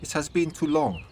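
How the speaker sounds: put-on soft German accent, pitched high; Gretel. A narrator's reading. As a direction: greet an old friend in a gentle German accent. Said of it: "in her soft German accent".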